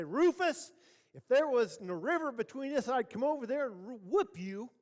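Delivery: high-pitched mock holler back; hollered threat. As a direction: call a threat across the river. Feuding neighbour yells threat back.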